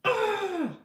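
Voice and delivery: loud, high exasperated ugh; a real burst of frustration; frustrated groan. Real, not acted. Burnt the interfacing.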